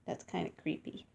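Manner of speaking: whisper. Real, not acted. Creeped-out whispered aside.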